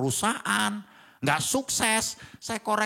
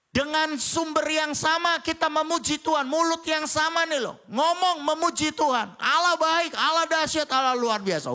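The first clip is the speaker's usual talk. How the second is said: raised, higher preaching voice building up; full-throated pulpit delivery; fervent preaching; 10 semitones higher, 3 dB louder than usual. Acted. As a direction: preach it out to the congregation.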